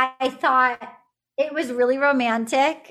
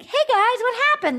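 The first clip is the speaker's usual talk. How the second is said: mock cheerful; 10 semitones higher than usual. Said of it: "Will comes back and he's like".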